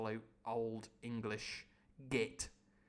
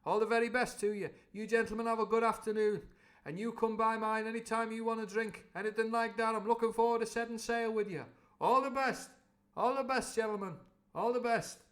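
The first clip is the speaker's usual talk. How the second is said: high, louder cheery cook; Long John goodbye; 8 semitones higher, 4 dB louder than usual. Acted. Long John bowing out to the gentlemen, loud and cheery. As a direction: fawn over them as you leave. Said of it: "tucking his forelock like that".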